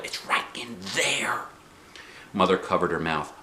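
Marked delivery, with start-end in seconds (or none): 0.0-1.5 hushed and slow; whisper. A narrator's reading. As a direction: whisper so the bird stays put. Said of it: "pointed to my backpack".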